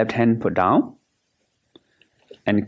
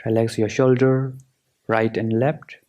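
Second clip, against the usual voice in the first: guided meditation. Acted. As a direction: guide them through relaxing, unhurried and measured.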